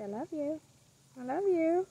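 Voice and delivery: high sing-song I love you; coaxing prompt. Shown as a demonstration. Owner teaching her macaw to talk, high coaxing. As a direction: model the words for the bird to copy.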